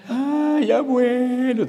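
high, loud mocking funeral wail; mock wailing mourner. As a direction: ham up a crying relative at the coffin.

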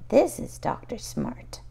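plain reading voice

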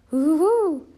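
high, loud bouncy hoo-hoo-hoo; loud Tigger whoop. Acted. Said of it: "with a loud".

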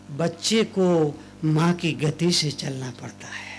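low, breathless whisper; hushed aside; whispered wrong line. Shown as a demonstration. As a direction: slip it out under the breath. Wrong version whispered before correction.

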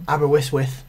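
"Aberystwyth" with lisped S sounds; "Aberystwyth", the S softened towards TH; lisped pronunciation. Shown as a demonstration. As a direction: stumble into a lisp on "Aberystwyth". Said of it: "it becomes a lisp".

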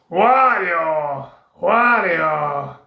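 drawn-out game character call; Wario. Acted. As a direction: stretch the name out in a put-on cartoon voice. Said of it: "now the next one is Wario".

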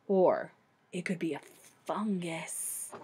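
a low, quiet expert cutting in; Doctor Gourd voice. Acted. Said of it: "added Doctor Gourd instantly".

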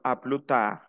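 "A plus tard" as "a plu taa", no S; French "A plus tard" with an open "plu"; silent S. Shown as a demonstration. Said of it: "no la menciono".

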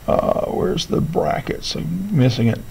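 breathy whisper to himself, slowed down; half-whispered mutter while searching the screen; whispered aside. Real, not acted. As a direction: drop to a whisper while hunting for a key.

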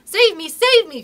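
high, loud plea for rescue; desperate cry. A narrator's reading. Nibs chased by wolves, desperate cry. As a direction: cry out for help, high and urgent. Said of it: "cried Nibs".

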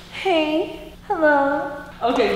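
normal talking voice

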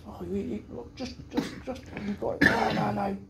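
a hint of a scared boy; panicky Gibbo. Mimicking the friend before the jump, panicky. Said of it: "so he says".

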